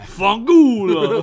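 slow, loud mock Italian; mock Italian curse. Co-host cursing a director, slow mock Italian. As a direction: stretch it out like a cartoon Italian.

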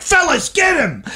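spring the fake trap with a strained call; mock police call. Imagined undercover cop calling fellas in to grab someone, mock police call.

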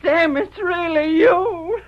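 slow, high, crying joy; tearful joy. Acted. Wife to rescued husband, crying with happiness. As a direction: sob it out in happy relief.

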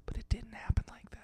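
unvoiced whisper. Real, not acted. Podcaster whispering a sly aside to the hosts.

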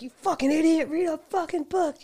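high-pitched mock angry insult; mock pissed-off. Pissed gambler to the guy across the table, mock pissed-off. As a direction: imitate an irate gambler hurling insults. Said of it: "he's, like, so pissed".

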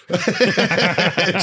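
slow, high laughing start. Real, not acted. Laughing while telling friends about the prank. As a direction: laugh through the retelling.

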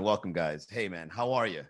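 usual speaking voice

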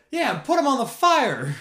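very high, loud shout; crowd joining in. Retold or imitated. Others at the campfire joining in, very high and loud. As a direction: cheer it out. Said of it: "whoever else was there".

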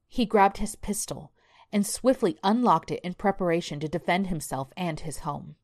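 ordinary delivery, nothing marked

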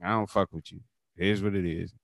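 low, soft shrug-off; retold blunt dismissal. Friend retelling Southern bluntness, low dismissal.

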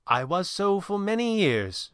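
a hint of an old servant's dignified reply; reserved old butler. Butler answering his prospective employer, lightly voiced and slow.

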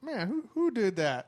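high, laughing fake innocence; mock innocence. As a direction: play dumb, laughing. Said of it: "I was just like".

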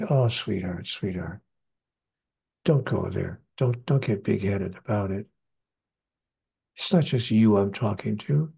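tender voice of God. Retold or imitated. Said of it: "And God said to me".

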